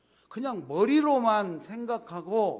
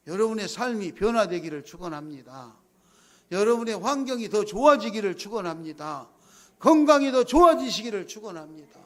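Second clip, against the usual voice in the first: building blessing; 6 dB louder than usual. Real, not acted. Pastor blesses congregation, building.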